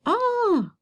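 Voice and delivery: high, drawn-out "Ah!"; pretend surprise. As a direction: react as if the child just got it. Pretends delight at pupil's answer.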